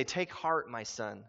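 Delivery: hushed loving reassurance; tender Jesus. Retold or imitated. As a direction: comfort her like a gentle father. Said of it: "what does Jesus say".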